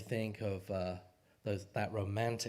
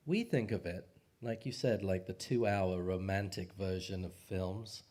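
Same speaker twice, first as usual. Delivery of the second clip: ordinary talk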